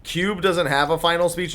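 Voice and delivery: ordinary talk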